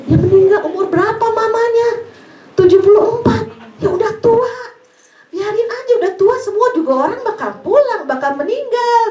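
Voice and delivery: dismissive reply. Retold or imitated. Blunt reply to grieving caller.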